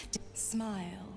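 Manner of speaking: whispering. Acted. Seductive incubus whispering temptations.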